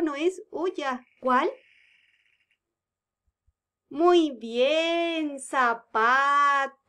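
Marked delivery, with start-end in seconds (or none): 5.4-6.9 slowly stretched zapato, each syllable separate. Shown as a demonstration. Teacher confirming the answer, sounding it out slowly. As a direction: spell out the sounds by voice. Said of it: "teacher sounds out answer".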